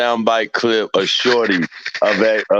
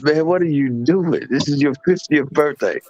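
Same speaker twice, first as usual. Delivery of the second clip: high incredulous retelling; imitating himself; 8 semitones higher than usual. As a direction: ask it in mock disbelief, pitched high. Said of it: "I said".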